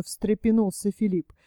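usual speaking voice

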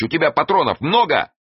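high-pitched shouted question; shouting. A narrator's reading. Эраст Петрович asks over the engine noise, shouting. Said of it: "крикнул Эраст Петрович".

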